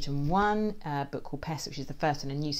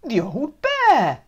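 emphatic blame; 8 semitones higher, 8 dB louder, slower than usual. Acted. Blaming the old bear.

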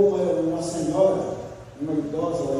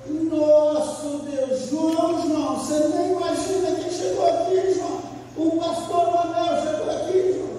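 put-on excited old lady; 11 semitones higher than usual. Old woman excited the pastor came.

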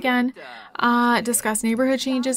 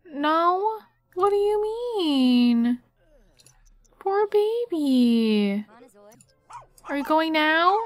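cooing sympathy; 4 semitones higher, slower than usual. Real, not acted. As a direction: pity the little dog tenderly. Pitying the dog.

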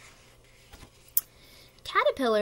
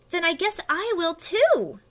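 high, loud, cheerful pollywog; happy; 8 semitones higher, 5 dB louder than usual. Acted. Pollywog decides to become a butterfly too, happily. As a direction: chime in gladly, pitched up. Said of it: "the pollywog said happily".

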